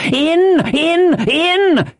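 very high, eager, raging yells; urgent shout. A narrator's reading. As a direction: fire off the command at a high pitch. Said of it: "he shouted".

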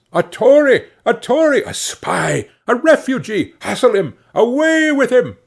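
high-pitched acted shouts of accusation; crowd shouting. A narrator's reading. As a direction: cry out like an outraged mob. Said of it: "a general shout burst from the bystanders".

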